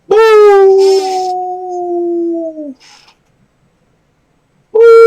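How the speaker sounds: long, loud high howl; imitated dog howl, held on one note. Shown as a demonstration. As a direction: howl like a dog to get it joining in. Howling along with the dog.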